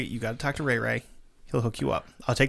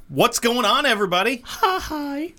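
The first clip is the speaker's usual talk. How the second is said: excited greeting; 9 semitones higher, 7 dB louder than usual. Real, not acted. Show greeting to listeners.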